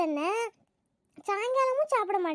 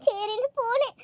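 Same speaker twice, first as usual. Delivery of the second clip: usual speaking voice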